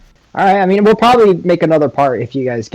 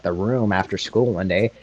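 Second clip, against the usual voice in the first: normal talking voice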